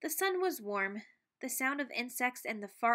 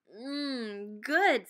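mmm good; 5 dB louder than usual. Acted. Savoring popcorn.

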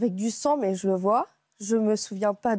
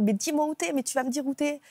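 raised higher, half-hearted retold yell; imitating a father yelling, pitched up; mock yell; 5 semitones higher than usual. Father yelling at his daughter on the phone, a mild mock yell. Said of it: "il hurle".

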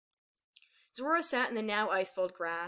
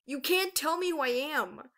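higher, slow, insistent protest; tearful defiance; 6 semitones higher, 4 dB louder, slower than usual. A narrator's reading. Aurora to her accuser, tearfully defiant. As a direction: push back, hurt and defiant. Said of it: "tears rushing down her face".

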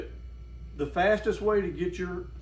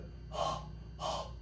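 fogging breath; 11 dB quieter than usual. Shown as a demonstration. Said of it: "like you fog a window".

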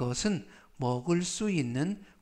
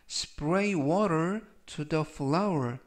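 "A vegetarian doesn't eat meat" read very slowly, word by word; slow model reading; much slower than usual. Shown as a demonstration. Teacher reads the example sentence slowly to students. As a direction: spell out each word slowly. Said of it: "즉 전체적 의미는".

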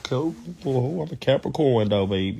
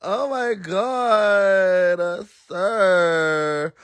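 slow, drawn-out cry of disbelief; overcome, drawn-out moan; scandalized wail; 4 semitones higher, much slower than usual. Real, not acted. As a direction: stretch each word into a wail. Overcome by retold remark.

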